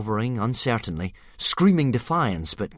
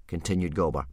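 usual speaking voice

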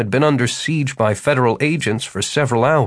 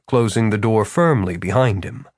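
usual speaking voice

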